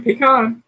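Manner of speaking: "pecan" said puh-KAHN, ah in the end. Shown as a demonstration. Host after spelling it out, puh-KAHN. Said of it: "That's how it's pronounced".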